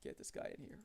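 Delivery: soft unvoiced mutter; muttered repeat. Real, not acted. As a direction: say it again under the breath. Repeats the call quietly.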